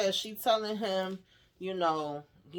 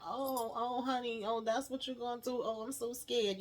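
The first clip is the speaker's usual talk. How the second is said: higher, softer sarcastic cooing; Donald's mock fear; 6 semitones higher, 4 dB quieter than usual. Donald taunting Lily as she points the gun, mock scared. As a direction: feign fright to taunt. Donald mocks being held at gunpoint.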